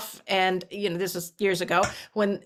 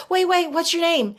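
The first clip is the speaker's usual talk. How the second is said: higher, louder, urgently asking his name; eager call after him; 9 semitones higher, 4 dB louder than usual. Retold or imitated. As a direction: call after him before he's gone. Calling after the departing stranger.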